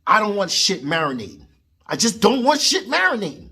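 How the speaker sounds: ordinary talk, nothing marked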